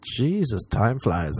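normal talking voice

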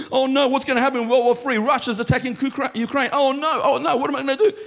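high, fast, fretful worrying; mock panic. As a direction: play a flustered worrier, hammed up. God imagined panicking over war.

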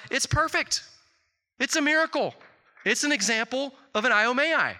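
high-pitched, emphatic mock amazement; amazed doctor. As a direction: declare a miracle as the astonished doctor. Acts doctor amazed at the chart.

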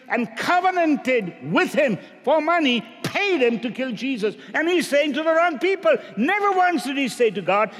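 high, loud preacher's cry; full-force sermon delivery; fiery preaching. Real, not acted.

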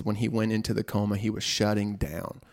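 ordinary delivery

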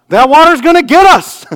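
put-on high, loud panicked little girl's cry; frightened yell. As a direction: shout the alarm like a scared toddler. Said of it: "she actually yelled out".

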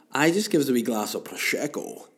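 lightly voiced Scottish accent, s slurred to sh; a hint of a Scottish Bond; Sean Connery impression. Mum answers the son in a Sean Connery accent. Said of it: "to become Sean Connery".

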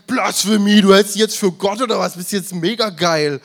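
high, loud, indignant crowd yell; hammed-up cry of blasphemy; outraged shout. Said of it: "Die Leute schreien".

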